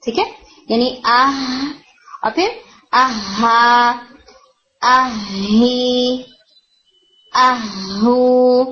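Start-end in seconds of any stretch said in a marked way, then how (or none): 0.7-1.7 slow آہ ending in a breathy ساکن ہ; ساکن ہا آہستہ; sukun demo, slow. Shown as a demonstration. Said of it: "حرکات چینج کریں". 2.9-8.6 very slow stretched آہا آہی آہو, doubled ہ then each vowel; حرکات کی مشق آہستہ; vowel drill. Shown as a demonstration. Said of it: "اس کی حرکات چینج کریں".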